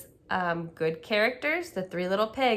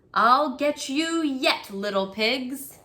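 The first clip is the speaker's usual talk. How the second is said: angry threat; 5 semitones higher, 4 dB louder, slower than usual. Acted. Angry wolf raises his fists.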